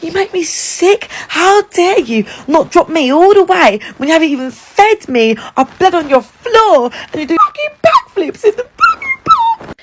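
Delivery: high-pitched furious yelling, close to tears; furious tearful shriek at a boyfriend who forgot his wallet; screaming at him. Retold or imitated. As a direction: scream it at him, voice cracking with rage. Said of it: "I'm screaming at him".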